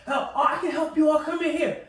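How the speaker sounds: put-on high lady's voice. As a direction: call them in as a mock helpful woman.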